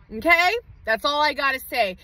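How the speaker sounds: ordinary talk, nothing marked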